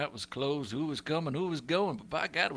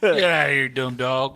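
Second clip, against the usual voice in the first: loud shoo at a dog; mock yell; 8 dB louder than usual. Champ yelling at a dog to get out, loud. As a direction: chase the dog off.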